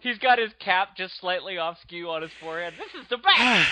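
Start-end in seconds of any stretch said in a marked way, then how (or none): 2.8-3.7 put-on high, loud child voice; thrilled little kid. Child in a skewed Rocketeer cap, high thrilled shout. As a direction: burst out as a delighted boy.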